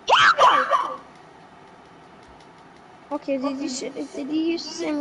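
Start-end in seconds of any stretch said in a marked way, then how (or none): 0.0-1.0 loud high yell; shouting a name. Real, not acted. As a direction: yell his name to get attention. Calling out to teammate.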